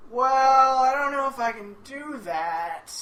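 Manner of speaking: loud, high, drawn-out "well" easing down; reluctant deputy. Retold or imitated. Deputy asked to show security footage, reluctant hedging. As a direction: stall reluctantly as the officer in charge.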